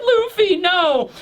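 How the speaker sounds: high, drawn-out mock wail; hammed-up anguished cry; tearful cry. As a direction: wail it in acted despair. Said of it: "starts to cry herself".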